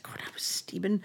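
very soft, mostly whispered retelling; under-the-breath admission; hushed confession. Retold or imitated. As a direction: drop to a whisper, as if owning up. Confessing to colleague about being drunk.

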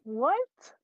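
high rising baffled question. Retold or imitated. Host still reacting to Travolta's slip, baffled. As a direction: let WHAT climb in disbelief.

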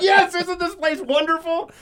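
high, gushing stranger voice; stranger's delight. Retold or imitated. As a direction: gush like a happy stranger in line. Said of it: "She's like".

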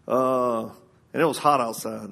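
ordinary delivery

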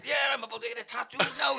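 loud, high-pitched shout; shouted reply. Retold or imitated. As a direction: yell it down the phone. Shouting down the phone.

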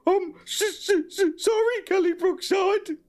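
high, squeaky stuttering apology; stammered feeble apology. Acted. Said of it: "stammered a feeble apology".

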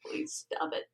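barely audible whisper; whispered plea. Real, not acted. Friend begging the joker to stop, whispered. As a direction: beg weakly under your breath.

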